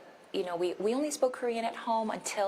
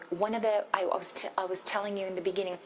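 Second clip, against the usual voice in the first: ordinary talk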